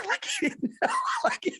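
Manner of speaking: laughing high through the words; cracking up mid-sentence. Real, not acted. Cracking up telling friends the Italian dude story.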